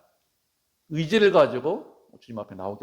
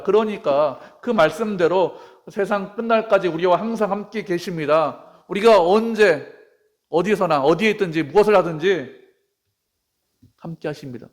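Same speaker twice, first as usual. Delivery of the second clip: loud, higher, slow emphatic preaching; forceful preaching; 5 semitones higher, 7 dB louder than usual. Real, not acted. Preacher promising God's presence to the world's end, forceful preaching. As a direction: drive each phrase home to the congregation.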